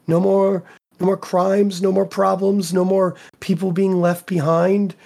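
lightly voiced persuasive villain; pleading villain.

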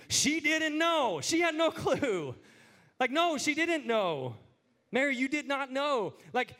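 high, drawn-out exclamation; emphatic rebuttal. Acted. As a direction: answer the song's question with exasperated force, stretching the words. Emphatically answers the song's question.

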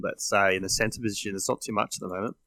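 ordinary talk, nothing marked

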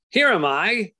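put-on child's reveal. Little girl jumping out from under a towel, playful high call. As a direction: pop out of hiding triumphantly. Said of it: "She would throw the towel off and say".

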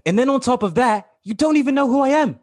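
put-out guest protesting; indignant. Acted. Guest complains about being unpaid.

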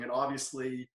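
normal talking voice

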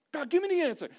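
loud, high-pitched mock demand to God; insistent asking. Believers demanding answers from God, insistent. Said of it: "A lot of times we're asking God".

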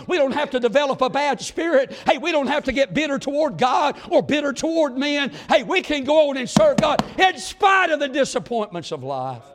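fiery preacher exhortation; preaching shout. Acted. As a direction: lift the voice to the church. Preaching at full force.

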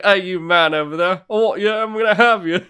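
mock swaggering flirt; bold flirt. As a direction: play the brazen seducer for laughs. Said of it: "And I'd be like".

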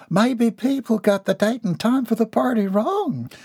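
lightly voiced high, fretting lady; a hint of worry, pitched high; worried lady. A narrator's reading. Worried older woman guessing at visitors.

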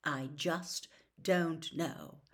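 very slow, heavy uncertainty; slow troubled. Acted. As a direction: let it sink, word by word. Sirius repeats, still unsure.

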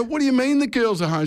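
very high, incredulous retold question; retold alarmed disbelief. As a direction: re-enact the startled question in a high pitch. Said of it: "I go".

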